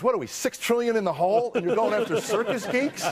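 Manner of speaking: high, laughing indignation; laughing outrage. Real, not acted.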